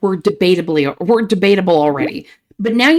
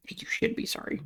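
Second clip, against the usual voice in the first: a sharp little whisper; 14 semitones higher, 18 dB quieter than usual. Acted. Player scolds the chat character in a whisper. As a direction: hiss it under the breath, scolding. Snippy reply to an apology.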